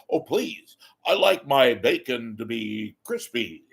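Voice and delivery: pompous announcer ordering breakfast; mock broadcaster cadence, punching the menu words; Steve Scott. Imagined newscaster orders at a little cafe, pompous announcer cadence. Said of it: "I can imagine him walking into".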